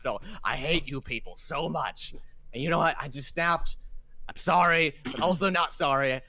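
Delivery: lightly acted bitter confession; vent, then give an unrepentant apology; exasperated ship captain. Captain admits he snapped at the visitors, exasperated and unrepentant.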